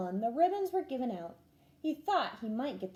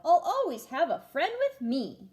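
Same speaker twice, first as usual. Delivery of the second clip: a louder sudden blurt; 5 semitones higher, 6 dB louder than usual. A narrator's reading. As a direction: burst out the remembered line. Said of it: "blurted out".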